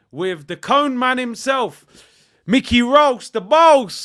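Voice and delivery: loud, high, slow hype intro; booming showman build-up; hype announcer. Acted. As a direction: announce him like a ring announcer. Hyping up a show segment.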